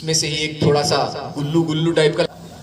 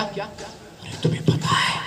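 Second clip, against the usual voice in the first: slow, higher conspiratorial whisper; secretive whisper; 6 semitones higher, 4 dB quieter, slower than usual. Acted. Grandfather whispers a secret to the boy.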